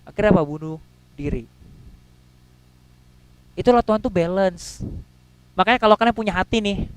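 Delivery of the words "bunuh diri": softer, dropped, weighty words; grave hush. Acted. Preacher warning the congregation in a low hush. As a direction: let the two words sink in quietly.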